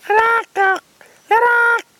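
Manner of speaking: very high drawn-out screech. Retold or imitated. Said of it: "big mob of the boys come up".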